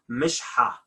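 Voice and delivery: mishha with a breathed h; classical h. Shown as a demonstration. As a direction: say mishha without rasping after sh. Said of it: "I should say".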